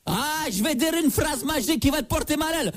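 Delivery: mystic curse voice. Acted. Fake psychic threatens a curse.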